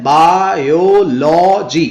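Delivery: Biology in four long, slow beats; slowly stretched biology, each syllable apart; four separate syllables. Shown as a demonstration. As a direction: count out बा-यो-लॉ-जी by ear. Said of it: "इसमें चार syllables हैं".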